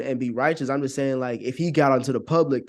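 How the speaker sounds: normal talking voice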